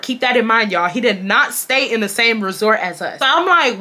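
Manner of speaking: usual speaking voice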